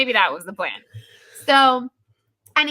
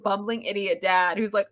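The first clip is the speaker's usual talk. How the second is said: usual speaking voice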